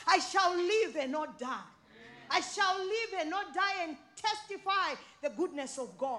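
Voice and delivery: loud, high preacher's declaration; full-voiced sermon cry; preaching. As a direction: proclaim it to the congregation.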